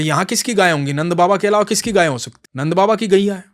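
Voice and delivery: put-on boy tells the devotee whose cows these are; cheeky boy. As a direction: answer as if it were obvious. Boy answers proudly.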